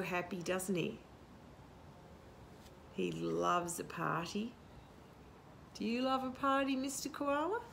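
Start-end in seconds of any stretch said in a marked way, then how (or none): none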